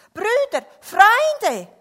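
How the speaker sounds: very loud, high call for help; urgent high-pitched storybook call. A narrator's reading. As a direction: holler for your friends. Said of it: "rief er".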